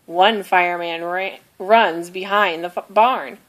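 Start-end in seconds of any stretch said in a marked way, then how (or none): none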